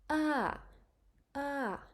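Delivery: "ah" starts high then falls down; falling tone ah. Shown as a demonstration. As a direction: lift "ah" high and let it drop. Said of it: "The voice goes up and then back down".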